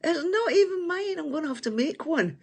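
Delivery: put-on high childish whining voice; mock whine. Complaining to the viewers, mock childish whine.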